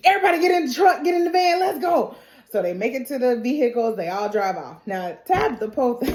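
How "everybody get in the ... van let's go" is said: high urgent call to flee; rally everyone to run; acted urgent command. Acting out calling everyone to flee.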